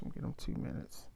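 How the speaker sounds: quick whispered aside to yourself; hushed and fast, like a private plan. Retold or imitated. Inner thought, timing the ranter.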